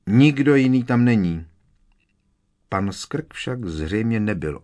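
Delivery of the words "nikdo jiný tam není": loud call across the clearing. A narrator's reading. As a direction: keep shouting after him. Still calling after Skrk.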